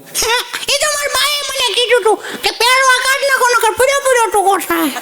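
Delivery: put-on high, innocent little boy; schoolboy repeating what his mother said; child's voice. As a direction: explain it like a naive kid. Boy explaining his uniform.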